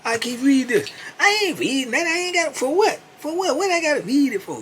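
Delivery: very high, indignant put-on voice; Keith refusing. Keith refusing to read the paper, high and indignant. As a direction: dodge it with squeaky outrage.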